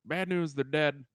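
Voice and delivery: loud, high-pitched announcing; movie cop impression. Co-host quoting the cop's line to the girls, loudly hammed-up. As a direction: call it out big, like the cop. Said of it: "when he goes".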